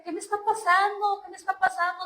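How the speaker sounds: high-pitched, urgent repeated question; retold desperation. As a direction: relive asking in mild desperation. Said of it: "me sentía tan desesperada". (desesperación revivida, voz más aguda)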